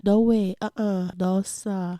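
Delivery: usual speaking voice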